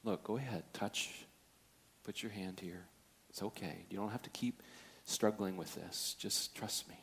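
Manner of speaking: hushed and unhurried, quietly reassuring a doubter; gentle but firm. Retold or imitated. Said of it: "very gently but firmly says".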